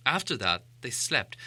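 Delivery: plain reading voice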